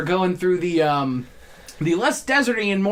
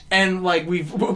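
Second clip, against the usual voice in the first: ordinary delivery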